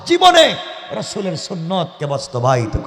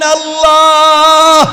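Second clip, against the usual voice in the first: long, high, loud cry to God; preacher imitating an anguished invocation; Amena's tearful call; 6 semitones higher, 9 dB louder, much slower than usual. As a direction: call out in a sustained plaintive chant. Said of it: "কাঁদতেছেন আর ডাক দিয়া বলছেন".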